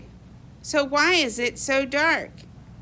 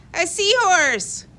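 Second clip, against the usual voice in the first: higher, louder delighted cry; excited cry; 7 semitones higher, 4 dB louder than usual. Acted. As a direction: call it out, thrilled at last. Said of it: "cries Pete".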